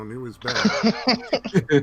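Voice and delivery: mock-ominous pronouncement, words stretched; ominous verdict. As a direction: drop it like a grim warning. Dramatic verdict on new things.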